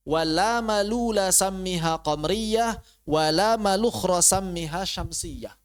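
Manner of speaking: slow, drawn-out Arabic verse recitation. Shown as a demonstration. Recites verse with corrected reading.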